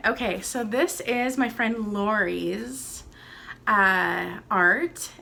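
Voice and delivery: normal talking voice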